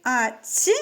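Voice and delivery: normal talking voice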